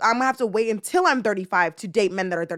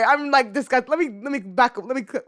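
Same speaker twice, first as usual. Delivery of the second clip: ordinary delivery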